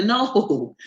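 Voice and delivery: usual speaking voice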